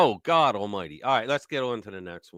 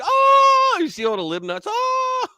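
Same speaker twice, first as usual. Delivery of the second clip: mocking shocked oh; 6 dB louder, slower than usual. Mocking shocked crowd reaction.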